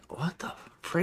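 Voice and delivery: soft, voiceless mock disbelief; baffled whisper. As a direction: whisper it as the stunned detective. Retold baffled detective on phone.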